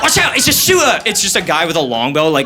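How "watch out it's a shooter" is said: mock British alarm. Acted British warning of a gunman.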